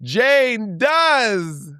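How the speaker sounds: high put-on triumphant cry; high mock victory cry; contestant's triumphant line. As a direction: shout it up high like a winner.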